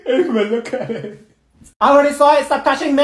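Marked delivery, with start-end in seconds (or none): 1.8-3.0 annoyed loud protest. Acted. Annoyed friend pushes away.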